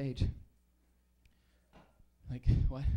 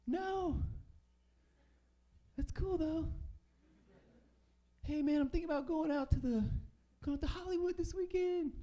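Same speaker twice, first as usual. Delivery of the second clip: high goofy voice; 14 semitones higher than usual. Retold or imitated. Comic writing back to McDonald's in a high breezy voice. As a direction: keep it breezy and chummy. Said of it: "And I wrote back".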